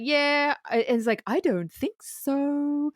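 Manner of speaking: slow, drawn-out taunting refusal; imitating Edward. Retelling Edward answering his boss, slow taunt. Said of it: "Edward's like".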